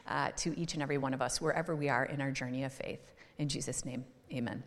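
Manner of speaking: soft, earnest prayer that fades toward the amen. Acted. Pastor praying over the congregation, soft and earnest. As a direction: pray quietly over the congregation.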